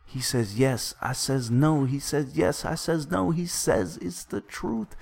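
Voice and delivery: animated, storytelling delivery of the lines; dramatic recitation. Acted. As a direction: act out a heated exchange while narrating.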